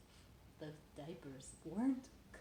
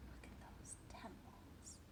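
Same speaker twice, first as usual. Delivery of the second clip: whisper; 10 dB quieter, slower than usual. Real, not acted. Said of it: "whispering aside to child".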